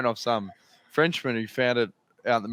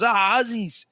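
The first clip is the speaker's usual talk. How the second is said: mock friendly stranger; 9 semitones higher, slower than usual. Cocky doctor greeting Aussie riders at a bar, high cheery mock. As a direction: imitate a brash bar guy.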